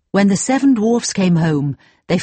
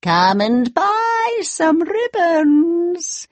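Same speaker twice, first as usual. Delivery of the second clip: put-on high, slow old crone voice; old peddler woman; 10 semitones higher, slower than usual. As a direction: sing out like a sly peddler. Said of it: "she cried".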